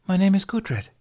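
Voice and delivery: put-on young woman voice. Gudrid introduces herself.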